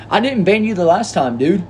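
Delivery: high pitched "I didn't ban you" defence; indignant protest. Acted. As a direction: push back, pitch jumping up. Viewer accuses him of banning.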